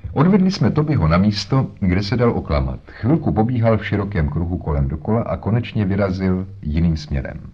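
ordinary delivery

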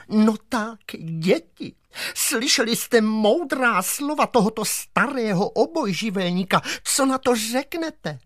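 sing-song teacher asking the class; teacher's voice. Acted. Teacher asks the pupils what they say, high and prim. Comrade teacher addresses kids.

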